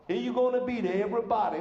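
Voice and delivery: mock Southern-Brooklyn mixed accent; half Southern, half Brooklynese. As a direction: put on a hammed-up New Orleans Brooklynese. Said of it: "They talk like that".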